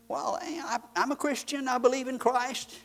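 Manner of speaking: high, mock-defensive churchgoer voice; mock complacent believer. Preacher mocking a churchgoer who only claims belief, high mock voice.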